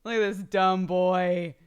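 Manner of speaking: loud, slow gleeful mockery; amused. Real, not acted. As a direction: savor calling him dumb. Showing the picture.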